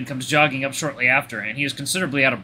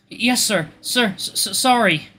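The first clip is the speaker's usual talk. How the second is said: high, halting, tearful reply; stammered apology; 8 semitones higher, slower than usual. Acted. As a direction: stumble over the words after sobbing. Drunk sniffling patient answers doctor.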